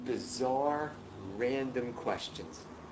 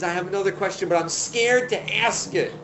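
raised, higher voice, hammed up; loud pestering; 6 semitones higher, 8 dB louder than usual. Visitor baiting Hillel with a question, loud pestering. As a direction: announce it loudly to the whole house.

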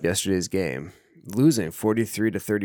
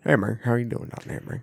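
put-on Southern accent; 2 semitones higher, 3 dB quieter than usual. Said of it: "accent talk, deep South".

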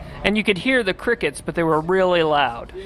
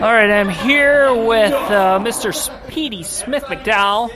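announcer voice; 2 semitones higher, 4 dB louder than usual. Acted. Mock red carpet interview intro.

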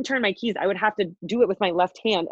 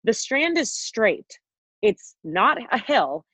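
slow, deliberate retold cross-examination; imitating sceptical dad; 3 semitones higher, much slower than usual. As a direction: spell it out slowly, as a doubting father would. Retold dad doubting the excuse.

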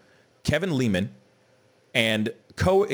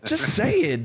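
exasperated rant; 13 semitones higher than usual. Real, not acted. Ranting at pretentious customers.

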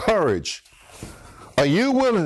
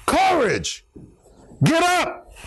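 high, emphatic call; rousing preacher's exhortation; enthusiastic command; 9 semitones higher, slower than usual. Acted. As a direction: call him boldly to his feet. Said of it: "they said it with some enthusiasm".

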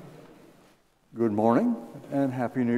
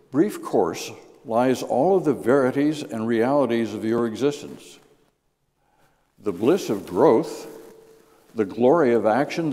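louder, measured poetic reading; poem reading; 4 dB louder than usual. Real, not acted. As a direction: savor each line of the poem. Reads poem aloud.

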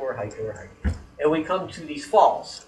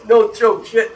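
ordinary talk

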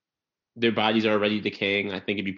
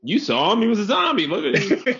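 high, loud, laughing mock protest; mock excuse; 11 semitones higher, 6 dB louder than usual. Pretend shooter pleading his case to the cops, high and loud. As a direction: squeal the excuse through a laugh.

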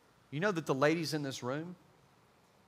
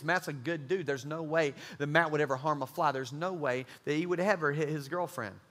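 ordinary talk, nothing marked

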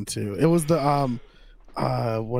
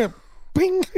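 squeaky bing. Pretend. Silly sound.

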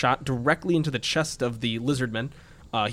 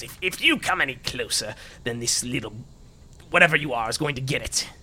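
threatening aristocrat. Acted. Game master voices hostage-taking aristocrat.

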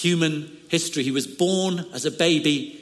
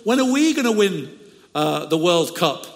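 put-on old Dutch man praying; 4 semitones higher, 4 dB louder than usual. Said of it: "was praying and said".

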